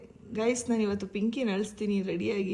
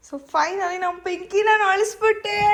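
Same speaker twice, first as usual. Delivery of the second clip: higher, louder, slower victory boast to viewers; triumphant announcement; 8 semitones higher, 8 dB louder, much slower than usual. Real, not acted. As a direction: proudly declare the prank worked. Announcing prank success to viewers.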